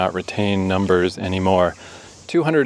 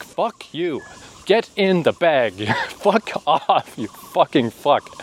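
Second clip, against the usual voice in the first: mock furious cursing; 5 semitones higher, slower than usual. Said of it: "hitting it, like".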